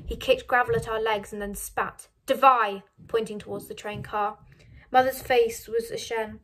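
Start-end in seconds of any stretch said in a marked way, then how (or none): 2.3-2.8 loud, forceful single command; barked order. A narrator's reading. Guard pointing family towards train car, barked order. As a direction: order them to move, loudly.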